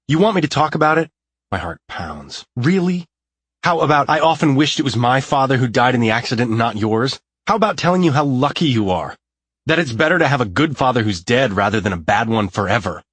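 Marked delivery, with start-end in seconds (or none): 0.1-1.1 loud, fast, higher furious challenge; heated bitter retort; angry outburst. A narrator's reading. Bitter quarrel, he erupts. 2.6-13.0 loud, higher, cutting tirade; raised angry bitterness; bitter furious rant. A narrator's reading. Bitter quarrel, he erupts.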